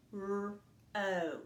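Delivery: P-R-O said sound by sound; P-R-O stretched out slowly sound by sound. Shown as a demonstration. Teacher sounding out P-R-O to find the vowel. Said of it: "Listen for that vowel sound".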